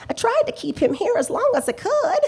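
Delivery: chuckling through the words, pitched high; pleased with herself, laughing as she talks; laughing proudly. Acted. Landlady boasting to the detectives, laughing.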